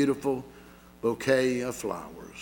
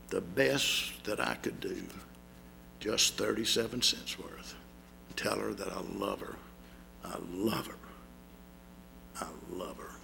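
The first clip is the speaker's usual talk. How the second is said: fading hushed words; 6 semitones lower, 9 dB quieter than usual. A narrator's reading. Doctor repeats the boy's dying words, fading to a whisper. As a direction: trail off into a whisper.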